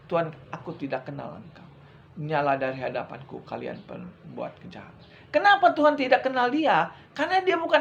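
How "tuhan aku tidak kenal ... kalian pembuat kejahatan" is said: much lower and softer, dropping away at the end; solemn divine verdict. Acted. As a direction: pronounce the sentence darkly. The Lord's rejection, voiced low. (suara Tuhan yang rendah dan khidmat menolak)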